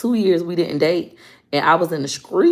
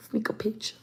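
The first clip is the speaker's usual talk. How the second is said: hushed, unvoiced whisper; whispered on the sly; sneaky whisper; 5 semitones higher, 12 dB quieter than usual. Shown as a demonstration. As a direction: whisper it like a secret. Echoes the secret act in whisper.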